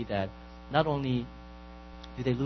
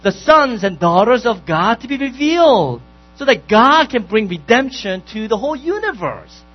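loud, ringing sermon delivery; preaching at full force; 8 semitones higher, 11 dB louder than usual. Acted. Pastor exhorting his congregation, loud and ringing. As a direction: preach it out with fire to the whole room.

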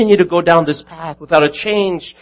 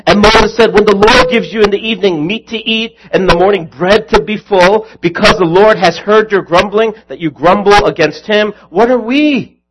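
louder, higher scripture rebuke; Moses rebuking; 5 semitones higher, 6 dB louder than usual. Retold or imitated. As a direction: proclaim the rebuke with force. Moses rebukes grumbling people.

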